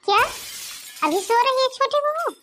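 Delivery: high, stunned cry of disbelief; can't believe she's still in bed; shocked disbelief. Acted. Mother-in-law shocked her daughter-in-law still sleeps, high outraged disbelief.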